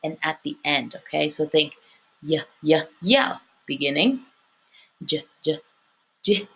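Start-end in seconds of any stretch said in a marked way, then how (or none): 2.2-3.4 y as a short YUH, repeated separately; spaced-out repeats of consonant y; Y sound demo. Shown as a demonstration. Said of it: "Practice pronouncing the Y sound". 5.0-6.5 j as a short isolated sound, repeated; spaced-out phonics repeats of j; letter sound demo. Shown as a demonstration. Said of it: "Practice pronouncing the Y sound".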